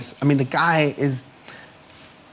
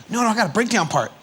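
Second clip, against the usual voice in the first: higher, quick imitation of an insistent kid; 7 semitones higher, faster than usual. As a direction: insist quickly, lightly playing the younger bro. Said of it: "He's like".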